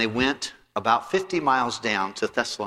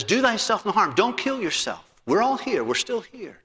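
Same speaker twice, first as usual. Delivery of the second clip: urgent plea; 8 semitones higher than usual. Retold or imitated. Paul urges the jailer.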